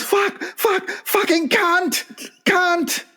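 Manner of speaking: very high mock shriek of rage; furious chief. Chief rounding on the guy who burnt the hut, shrieking. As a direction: shriek at the fire-maker, high. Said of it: "looks at the guy and goes".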